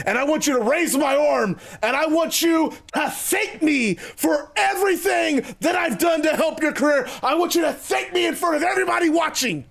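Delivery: high, building wrestler demands; Jericho, demanding. Acted. Wrestler orders rival to thank him publicly, high and insistent.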